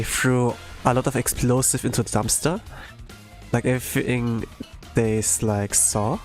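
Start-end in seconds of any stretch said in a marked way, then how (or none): none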